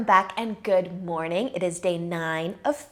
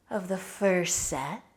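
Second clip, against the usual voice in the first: guided meditation; 5 dB quieter, slower than usual. Acted.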